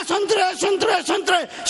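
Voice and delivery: high, insistent imitated vendor call; hawker's cry. Orange seller ignoring the committee, hawker's cry. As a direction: keep crying your oranges, pitched high. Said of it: "बोलता गया".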